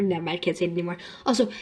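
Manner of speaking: usual speaking voice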